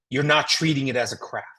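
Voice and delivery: ordinary delivery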